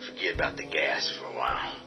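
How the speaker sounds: low whisper. Acted. Hushed planning.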